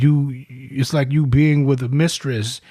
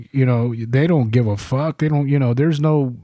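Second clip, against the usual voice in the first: usual speaking voice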